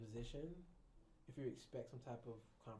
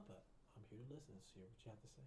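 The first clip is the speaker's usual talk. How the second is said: much softer, gentle listening tone; calm, soft reply; 12 dB quieter than usual. Shown as a demonstration. Therapist answers the ranting patient, soft and calm. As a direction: settle them softly. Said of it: "real calm with my voice".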